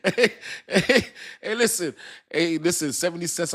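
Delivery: excited. Real, not acted. Hyped calling out to viewers.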